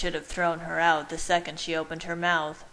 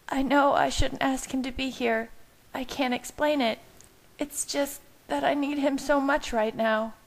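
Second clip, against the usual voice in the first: high, tearful pleading; sobbing; 8 semitones higher than usual. A narrator's reading. Bella explains needing him to Leah, acted sobbing. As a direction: plead helplessly as if crying. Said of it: "Bella sobbed".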